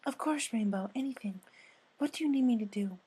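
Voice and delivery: high timid pony asking kindly; gentle Fluttershy. Acted. Narrator voices Fluttershy.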